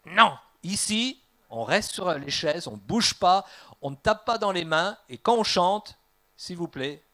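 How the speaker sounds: stern rule-giver. Acted. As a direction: lay down the rules forcefully to the congregation. Acted stern rule-giver in church.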